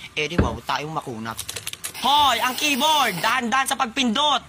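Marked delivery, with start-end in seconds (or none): 2.0-4.4 slow, higher-pitched telling-off; drawn-out exasperated scolding. Acted. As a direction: call out a sharp warning to careless students. Teacher scolds students over the keyboard.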